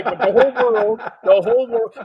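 loud, high, through laughter; real laughing. Real, not acted. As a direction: keep talking over your own laugh. Host laughing at guest's blunt answer.

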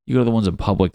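usual speaking voice

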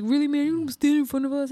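high, loud indignant complaint; annoyed guy. Retold or imitated. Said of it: "He was like".

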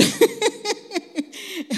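loud, high burst of laughter; hearty laugh. Real, not acted. Laughing at God's joke.

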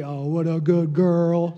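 slow, cooing voice for a little dog; pet talk. Retold or imitated. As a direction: praise your dog the way owners do. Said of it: "Y'all talk like that too to your pets".

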